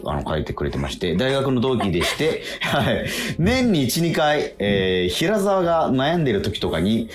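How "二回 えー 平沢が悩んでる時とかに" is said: ordinary talk, nothing marked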